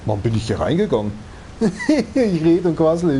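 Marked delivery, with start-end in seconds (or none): 1.6-3.2 high laugh then slow chatter. Real, not acted. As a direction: laugh at yourself, slowing down. Laughing at own rambling.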